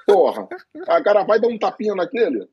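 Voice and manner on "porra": exclaimed curse. Real, not acted. Curse at the slap.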